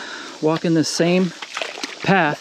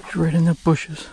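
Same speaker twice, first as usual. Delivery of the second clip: ordinary talk, nothing marked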